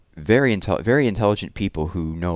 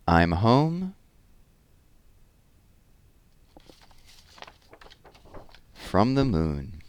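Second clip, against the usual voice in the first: lively, sing-song read-aloud of a rhyming book; reading to children; 4 semitones higher, much slower than usual. Acted. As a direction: read it with bounce for the little ones. Reads rhyming rocket picture book.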